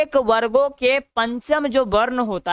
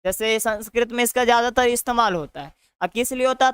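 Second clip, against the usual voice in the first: ordinary talk, nothing marked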